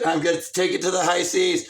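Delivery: loud, low pirate declaration; big booming buccaneer voice; pirate captain. Acted. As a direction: announce it like a sea captain. Voices pirate character in bit.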